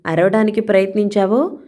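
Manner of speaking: plain reading voice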